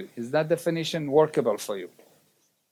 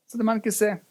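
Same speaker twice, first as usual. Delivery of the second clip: normal talking voice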